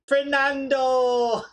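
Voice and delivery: long, drawn-out, high shout; shouted catchphrase. Retold or imitated. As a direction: belt out the name slowly like a TV host. Take Me Out host catchphrase.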